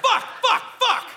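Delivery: very high, strained curse; mock outburst in the cereal aisle; frustrated yell. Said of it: "the cereal aisle going".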